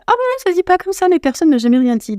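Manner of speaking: high-pitched, taken-aback protest; mock indignant surprise. Jenny answering her boyfriend's correction, retold indignant surprise. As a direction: jump up high in disbelief.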